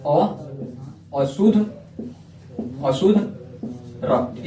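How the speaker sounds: halting word-by-word delivery for note-taking; slow dictation. Real, not acted. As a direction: piece by piece with long gaps. Dictates term while writing it.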